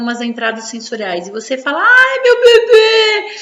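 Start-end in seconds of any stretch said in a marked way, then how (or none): 1.8-3.4 put-on high, slow, loud sing-song baby talk; motherese. Shown as a demonstration. Mother cooing loudly and slowly to her baby. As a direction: coo at the baby, way up high and drawn out. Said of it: "Esse mamanhês".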